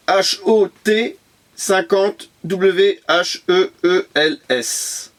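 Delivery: letters read out one by one, slowly; slowly spelled out. Real, not acted. Spelling the car label slowly for viewers, letter by letter.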